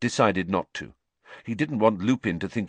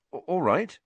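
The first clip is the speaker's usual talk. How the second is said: higher, stammered acceptance; awkward; 9 semitones higher than usual. A narrator's reading. Harry accepting tea from his teacher, awkward. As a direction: trip slightly on the first sound. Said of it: "said Harry awkwardly".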